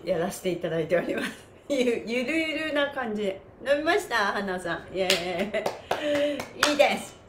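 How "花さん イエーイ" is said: normal talking voice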